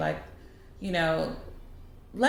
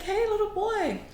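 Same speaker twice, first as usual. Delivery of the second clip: calling out. Retold or imitated. Old lady getting a boy's attention, high calling voice. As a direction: sweetly call a child over, high-pitched.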